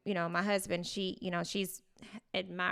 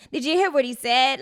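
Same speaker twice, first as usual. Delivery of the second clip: put-on No Name's thick accent; 5 semitones higher, 8 dB louder than usual. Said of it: "His accent was very thick and was like".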